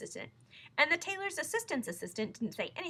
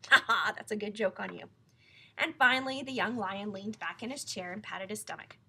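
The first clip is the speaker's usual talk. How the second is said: plain reading voice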